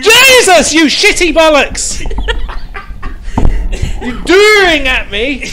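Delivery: very high, very loud scream into laughter; terrified shriek. Real, not acted. Player scared by his sneaking friend, shrieking then laughing.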